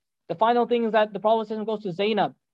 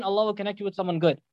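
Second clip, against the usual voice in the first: normal talking voice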